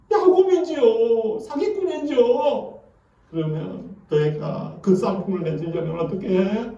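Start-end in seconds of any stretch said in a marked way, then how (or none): 0.1-2.8 high and slow, a humble confession; confessing Jacob. Retold or imitated. Jacob admitting his name to God, high and slow. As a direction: stretch it out higher, owning up.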